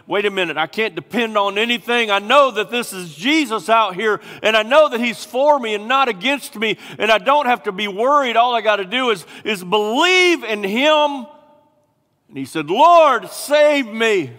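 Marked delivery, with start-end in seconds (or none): 0.0-11.2 higher and louder, anxious self-talk; building fear. Acted. Peter on the waves, fear rising, pleading with himself. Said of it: "the fear was building up inside of him". 12.7-14.3 much louder and higher, a raw plea; desperate cry. Acted. Peter sinking, crying out to Jesus in desperation.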